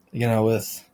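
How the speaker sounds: ordinary delivery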